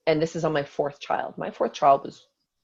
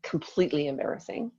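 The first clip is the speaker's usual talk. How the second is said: ordinary talk, nothing marked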